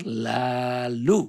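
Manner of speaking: drawn-out "terlalu" with a long rolled r; signature catchphrase style. Acted. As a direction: stretch each syllable very slowly, rolling the r. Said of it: "kalau bahasa saya". ("terlalu" dipanjangkan dengan r bergetar)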